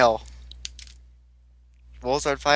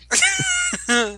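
shrill laugh burst. Real, not acted. Laughs after Kevin's hi.

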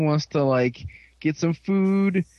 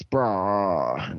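retold bro voice; 7 semitones lower than usual. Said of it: "And he's like".

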